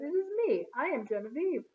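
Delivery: put-on light French accent, lifted high on me; a young French visitor's gentle French accent; hint of French accent. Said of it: "Her way of speaking was a bit strange".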